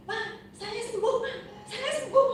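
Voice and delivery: high-pitched, elated retold exclamation; excited announcement. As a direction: reenact breaking joyful news, pitch leaping up. Retells announcing sudden healing. (seruan gembira bernada tinggi)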